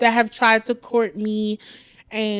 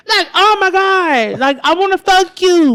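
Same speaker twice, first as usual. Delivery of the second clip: high, loud exasperation; exasperated outburst; 7 semitones higher, 7 dB louder than usual. Acted. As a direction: burst out in frustrated protest. Exasperated at being infantilized.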